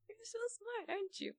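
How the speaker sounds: sweet, talking-down tone; condescending. Shown as a demonstration. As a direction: praise them like small children. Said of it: "it was like a little, you know".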